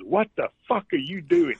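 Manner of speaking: high-pitched mock outrage; exasperated outburst. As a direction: retell an incredulous caddie losing his patience. Retold caddie's exasperated outburst.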